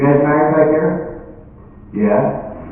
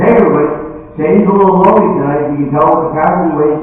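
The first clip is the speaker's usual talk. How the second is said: raised, high announcement; calling out; 5 semitones higher, 4 dB louder than usual. Acted. Bartender announcing Sadie's offer to the bar, calling out. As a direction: address the whole room over the noise.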